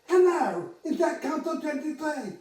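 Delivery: high, loud put-on voice of a prank caller; odd caller voice. Air traffic controller mimics a prank caller on the buzzer, high and loud. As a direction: squeak it out like a silly voice on the line.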